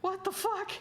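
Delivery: very high disbelieving squeak; stunned, voice cracks upward; shocked. Real, not acted. Shocked reaction to diary.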